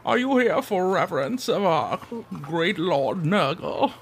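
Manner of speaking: put-on high, wavering old man voice; frail old man. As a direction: play a frail old temple keeper. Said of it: "this frail old man comes out in".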